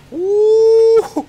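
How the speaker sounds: high, loud whoop; excited whoop. Real, not acted. Revealing his home theater to viewers, a high loud whoop. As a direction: cheer at the big reveal. Opening the movie theater door.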